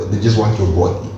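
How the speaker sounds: ordinary talk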